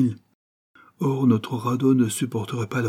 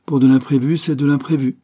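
lively, louder and higher, delighted; excited; 6 semitones higher, 7 dB louder than usual. Acted. Laurent thrilled by the unexpected, excited. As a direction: relish the surprise out loud.

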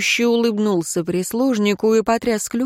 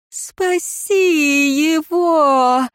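high, drawn-out wailing plea; long anguished groan of entreaty; moaning plea; 9 semitones higher, much slower than usual. A narrator's reading. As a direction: moan it slowly, pitched high. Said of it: "простонала мать девицы".

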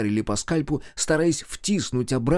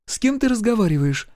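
put-on high-pitched young sister; questioning girl; 11 semitones higher than usual. A narrator's reading. Ранит approaches brother, questioning.

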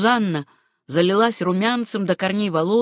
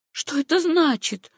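high, bewildered question; baffled by her words; amazed confusion; astonished question; 9 semitones higher than usual. A narrator's reading. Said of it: "в изумлении спросила Сюзанна".

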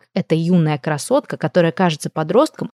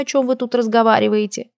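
plain reading voice

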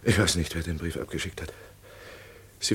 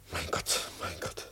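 despairing whisper; 11 dB quieter, slower than usual. Acted. Verzweifelter Arzt vor Verhaftung.

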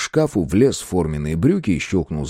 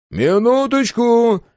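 high, drawn-out holler; long, sung-out shout with raised pitch; loud call; 5 dB louder, much slower than usual. A narrator's reading. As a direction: call out to whoever is at the door. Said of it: "громко сказал Андрей".

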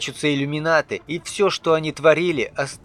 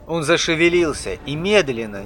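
ordinary delivery

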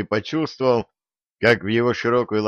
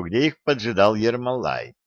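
ordinary delivery

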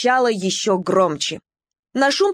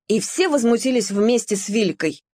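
ordinary delivery, nothing marked

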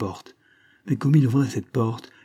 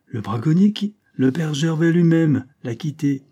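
higher, startled questioning; 5 semitones higher, 4 dB louder than usual. A narrator's reading. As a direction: react with a jolt of surprise. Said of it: "La belle Bourbonnaise sursauta".

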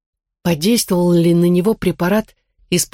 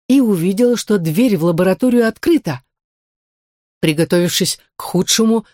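ordinary delivery

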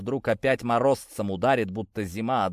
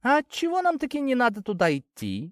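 much higher, insistent questioner; nagging high-pitched voice; pushy character voice; 12 semitones higher than usual. A narrator's reading. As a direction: butt in with a high, pestering question. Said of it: "настырно вылез вперёд Изя".

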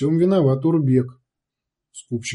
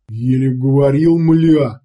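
loud, blustering thug pleading innocence; a rough savage swearing he's innocent; wheedling brute; 5 dB louder, slower than usual. A narrator's reading. Уйбуй рассказывает сородичам.

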